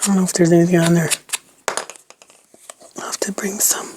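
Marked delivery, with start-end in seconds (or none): 3.0-3.9 whispering. Real, not acted. Musing to herself while crafting.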